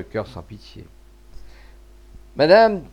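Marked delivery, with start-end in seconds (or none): 2.4-2.8 a loud, high cry of madame breaking with emotion; broken voice. A narrator's reading. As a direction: blurt it out as the voice cracks. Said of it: "d'une voix entrecoupée".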